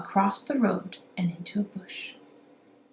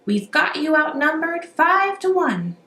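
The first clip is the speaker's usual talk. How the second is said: robbers crowing; 8 semitones higher, 5 dB louder than usual. A narrator's reading. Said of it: "the robbers cried".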